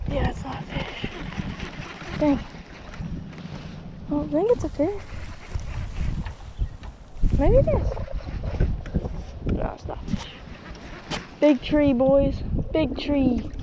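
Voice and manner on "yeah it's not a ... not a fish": disappointed muttering. Real, not acted.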